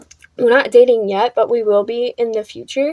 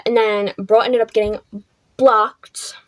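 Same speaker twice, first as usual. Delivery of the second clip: ordinary talk, nothing marked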